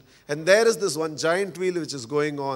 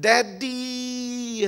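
a lightly voiced child calling Daddy with joy; joyful child's cry; 5 semitones higher than usual. Child spotting his father, joyful call. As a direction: call out Daddy as you run into his arms.

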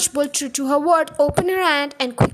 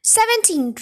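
higher-pitched pupil's voice; foolish abandon; 7 semitones higher than usual. A narrator's reading. Rupert answers the headmistress with foolish abandon. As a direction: blurt it out rashly, pitched up. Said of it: "answered with foolish abandon".

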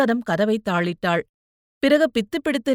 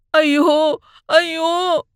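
anguished wail; 7 semitones higher, 5 dB louder, much slower than usual. Acted. Brother collapses writhing on floor.

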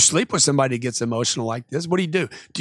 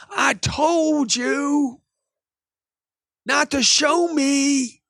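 high, drawn-out mock shout; hammed-up protest, every word dragged out; upset customer; 10 semitones higher, much slower than usual. Upset customer scolding salesman for showing too much, hammed-up upset. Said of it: "So he gets upset and says to me".

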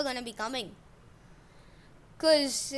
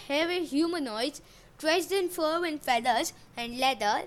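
plain reading voice

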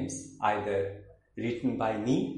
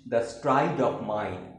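emphatic declaimed verse with high phrase openings; theatrical poem reading with swooping intonation; poetry recitation; 5 semitones higher, 5 dB louder, slower than usual. Acted. Recites third poem, In Return.

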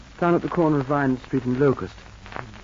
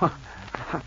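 normal talking voice

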